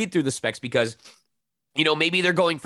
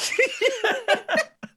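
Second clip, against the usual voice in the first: high burst of laughter. Real, not acted. Laughing at own joke.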